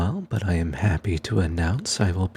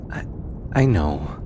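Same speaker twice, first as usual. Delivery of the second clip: lightly voiced researcher, fond and teasing, with thoughtful pauses. Researcher thanking their stubborn bodyguard, fond and sincere. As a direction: talk gently to a trusted companion, unhurried, hesitating between phrases.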